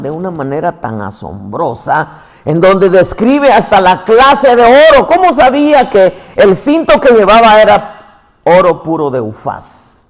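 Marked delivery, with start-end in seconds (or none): 2.4-10.0 loud, insistent preaching voice; fiery sermon delivery; full-force preaching. Acted. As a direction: preach it out to the congregation.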